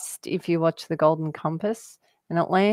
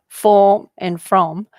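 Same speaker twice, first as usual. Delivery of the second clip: slow, loud, robotic delivery; 9 dB louder, slower than usual. Shown as a demonstration. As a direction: spell each word out like a robot. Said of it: "you sounded like a robot".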